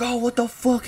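high-pitched reenacted panic; retold freak-out. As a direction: act out panicking in the water, voice jumping up. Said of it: "I started freaking the fuck out".

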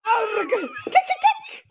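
high squeals of kick broken by Scottish arg; crazy River. Pretend. Player as crazy River mid-fight, shrieking kick kick.

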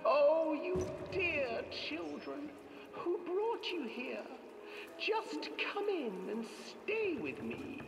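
high, slow sugary crone; witch voice. Acted. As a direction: coax them in like a witch.

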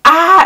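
high, loud "ah"; quick fangirl yelp; retold squeal of excitement; short mock scream. Acts out own excited scream.

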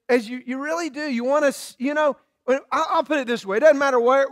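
normal talking voice

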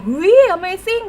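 high, loud and very drawn-out shocked gasp of amazement. Retold or imitated. Retelling meeting the old fan, acting her own stunned amazement. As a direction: stretch it out like you can't believe your eyes. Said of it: "แบบช็อก แบบช็อกแบบสุดๆ".

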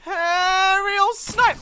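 drawn-out triumphant yell; excited shout. Real, not acted. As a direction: stretch it out in triumph. Sniper kill mid-fight.

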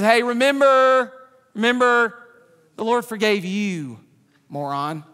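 a hint of a blunt, teasing God; God scolding him. Preacher voicing God calling him a moron, lightly mocking. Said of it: "That's how he talks to me".

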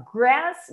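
GRASS with the A said as an open "eh"; E-sound vowel. Shown as a demonstration. Teacher showing students the A in grass, open E sound. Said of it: "tá com E".

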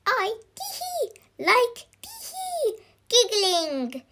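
very high, giggly character voice; giggling. A narrator's reading. Miss Giggles tells them her hobby, giggling. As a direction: squeak it out with tee-hee giggles. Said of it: "giggled Miss Giggles".

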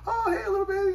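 very high-pitched imitation of cooing to an infant; baby talk. Adult greeting a baby they just picked up, baby talk. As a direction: put on a squeaky talking-to-babies voice. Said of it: "baby talk".

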